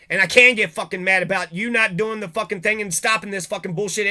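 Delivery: ordinary delivery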